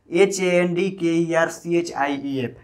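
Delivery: ordinary talk, nothing marked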